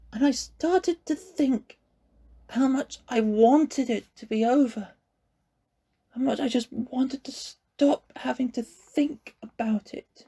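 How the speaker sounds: put-on a boy close to tears; tearful boy. A narrator's reading. Connor confesses, crying.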